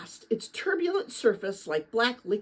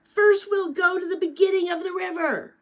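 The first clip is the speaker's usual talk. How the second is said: raised and high, calling right into his ear; loud call over the rushing river; shouting over the river; 8 semitones higher, 4 dB louder than usual. A narrator's reading. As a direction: shout to be heard over roaring water. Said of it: "shouted into his ear".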